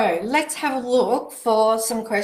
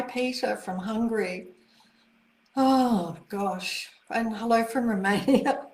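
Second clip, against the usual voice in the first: ordinary talk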